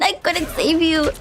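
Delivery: ordinary talk, nothing marked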